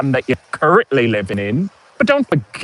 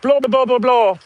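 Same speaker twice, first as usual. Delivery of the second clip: high mocking posh babble; mock British accent; 10 semitones higher than usual. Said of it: "with this kind of".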